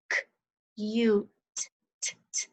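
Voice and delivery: "u-t" broken into long u and a short t; isolated sounds. Shown as a demonstration. Teacher asking for the next sound, "u-t" sounded out piece by piece. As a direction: tap out each sound, repeating "t" crisply alone. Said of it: "Next sound".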